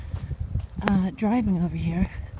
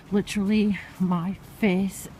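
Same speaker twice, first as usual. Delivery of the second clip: words slurred by a numb mouth; slurring from cold; 2 semitones higher, 7 dB louder than usual. Real, not acted. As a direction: talk through frozen lips, slurring. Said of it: "Hence the reason I am slurring my words".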